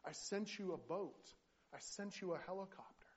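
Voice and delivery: reply patiently, spacing out each item; lightly voiced Lord. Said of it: "the Lord says to him".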